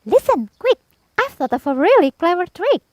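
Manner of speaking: very high, eager tiny-bug voice; whisper-quick excitement over a clever plan; ladybird plotting. A narrator's reading. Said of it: "the ladybird said".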